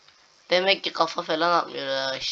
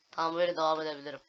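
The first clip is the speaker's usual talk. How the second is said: ordinary delivery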